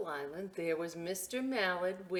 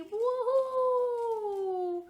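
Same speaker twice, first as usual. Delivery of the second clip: sung-out whoop, much higher and louder; 7 dB louder than usual. Real, not acted. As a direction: whoop it like a party cheer. Welcoming kids to duck table.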